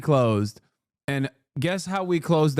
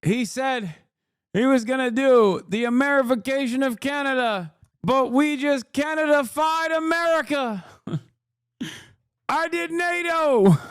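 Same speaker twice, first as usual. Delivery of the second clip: much higher, laughing Trump impression; imitating Trump; 9 semitones higher than usual. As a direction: crow it like Trump bragging, high and laughing. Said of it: "gonna come out and be like".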